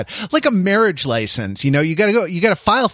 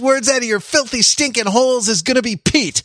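high, hammed-up drill instructor yell; mock drill sergeant; drill sergeant bark; 7 semitones higher than usual. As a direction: bark it like a boot camp sergeant. Drill sergeant bit opening show.

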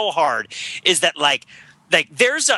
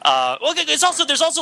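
usual speaking voice

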